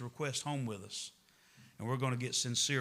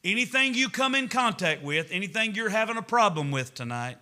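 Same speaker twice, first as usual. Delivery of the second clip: much higher and louder, urgent; preaching at full force; 5 semitones higher, 7 dB louder than usual. Acted. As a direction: drive it home to the church.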